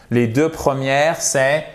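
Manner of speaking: normal talking voice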